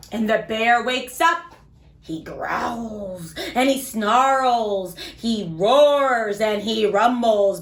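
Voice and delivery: put-on lively, sing-song storybook read for little kids; reading to children. A narrator's reading. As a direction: read it like bedtime story time for small children. Reads picture book to kids.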